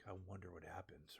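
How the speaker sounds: breathless ominous anime whisper; deep anime whisper. Retold or imitated. Reviewer imitating an anime close-up scene in a whisper. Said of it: "they just get in that deep".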